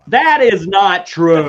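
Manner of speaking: loud, high, drawn-out protest; playfully outraged, every word stretched out loud; mock outrage. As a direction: slowly belt out an indignant denial.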